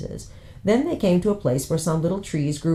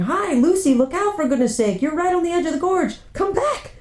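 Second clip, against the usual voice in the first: much higher, alarmed calling out; shouting warning; 13 semitones higher than usual. Acted. Siblings shouting to Lucy at the gorge edge, alarmed. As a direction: call her back from the edge. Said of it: "voices of the others shouting".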